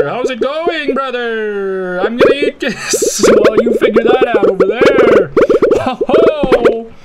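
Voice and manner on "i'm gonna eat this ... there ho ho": loud boastful hype. Pretend. Boasting as the biggest snake.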